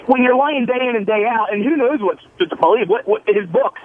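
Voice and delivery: usual speaking voice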